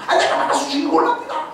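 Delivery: high, bold volunteering; 높고 당돌하게 나서는 소년; eager boy. Acted. (다윗이 어른들에게 자신 있게 나서겠다고 한다)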